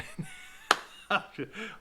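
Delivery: a real high burst of laughter. Real, not acted. Laughing at the guru's pitch, a real burst of laughter. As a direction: crack up laughing. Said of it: "I can't even get through the video without laughing".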